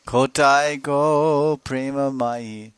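high, drawn-out lament calling Radha; crying in separation. Acted. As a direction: cry out her name in longing. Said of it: "he cries in separation".

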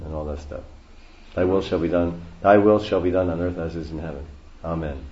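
ordinary delivery

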